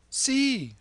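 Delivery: high, loud excited shriek; shrilled. Acted. Courtesan shrieking to the carriage about the lama, high. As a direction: squeal it out high. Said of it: "shrilled the Amritsar girl".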